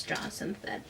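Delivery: ordinary delivery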